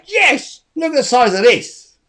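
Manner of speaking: imitating a gleefully proud man; happy, proud quote. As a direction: show off a prize with high, loud joy. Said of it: "he's so happy holding big cabbages".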